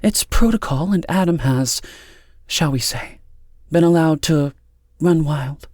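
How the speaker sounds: cool voice. A narrator's reading. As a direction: lay down the rules with deliberate pauses. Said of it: "and in a cool voice said".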